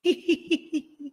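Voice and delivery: real high-pitched chuckle in short bursts; giggle. Real, not acted. Amused by viewer comment.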